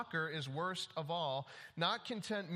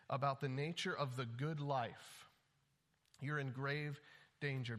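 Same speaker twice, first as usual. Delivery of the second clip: usual speaking voice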